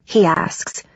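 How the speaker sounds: usual speaking voice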